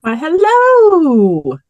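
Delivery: ordinary delivery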